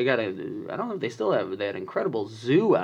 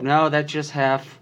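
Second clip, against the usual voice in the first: reassuring parent; 2 semitones higher, slower than usual. Acted. Parent calming scared child.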